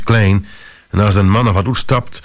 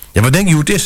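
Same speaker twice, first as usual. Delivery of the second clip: furious; 8 semitones higher, much faster than usual. A narrator's reading. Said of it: "Mevrouw was razend".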